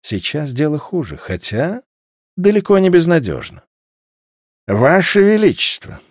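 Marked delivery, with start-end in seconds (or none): 4.7-6.0 put-on mumbling old doyen. A narrator's reading. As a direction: mumble it toothlessly like an old envoy. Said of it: "прошамкал дуаен". (шамкающий старик)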